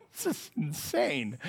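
very soft, slow this is insane; stunned mutter. Retold or imitated. Preacher stunned by the gangsters' yes, soft and slow. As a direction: breathe it out in disbelief.

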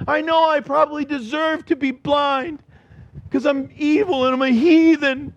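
acted appeal of a self-abasing sinner; pleading beggar. Acts blind man pleading for mercy.

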